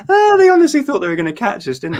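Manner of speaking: high, loud mocking tone; mock the rivals in a sing-song. Real, not acted. Mocking rivals, much higher and louder.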